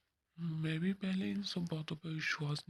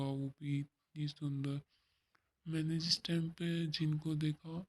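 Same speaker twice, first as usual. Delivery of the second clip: usual speaking voice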